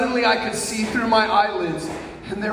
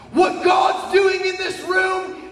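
prophetic scream; 12 semitones higher than usual. Retold or imitated. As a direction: scream it out like a prophecy over the room. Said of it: "start screaming at the top of my lungs".

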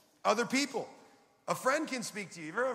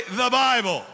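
very loud, emphatic rallying call; booming prompt for a congregation to repeat; like you mean it; 12 dB louder, slower than usual. Shown as a demonstration. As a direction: shout it with full conviction. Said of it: "Say it like you mean it".

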